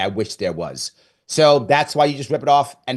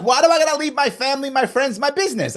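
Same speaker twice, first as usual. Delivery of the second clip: much higher, fast, retold frustrated rant; exasperated outburst; 12 semitones higher than usual. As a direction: pitch it up high and rush the complaint like you're fed up. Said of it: "I got mad".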